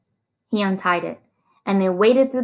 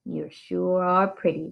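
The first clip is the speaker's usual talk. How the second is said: put-on leering drunk; 5 dB quieter, slower than usual. A narrator's reading. Drunk sheriff leering at teacher.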